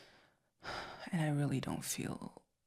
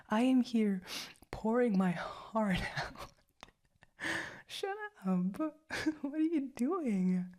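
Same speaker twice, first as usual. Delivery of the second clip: high, laughing, half-offended; giggling protest; 8 semitones higher, 3 dB louder than usual. Acted. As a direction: protest while cracking up. Partner laughs at confession.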